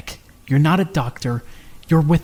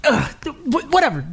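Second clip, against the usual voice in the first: flustered brush-off; 8 semitones higher than usual. Acted. Embarrassed brush-off.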